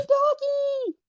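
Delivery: very high, loud squeal; excited squeal. Real, not acted. Player spotting a doggy in the game, excited squeal. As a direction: squeal it loudly, far up in pitch.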